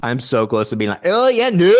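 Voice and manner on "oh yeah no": high, loud bad Scottish accent. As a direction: blurt a badly faked Scottish accent. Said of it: "in a really bad Scottish accent".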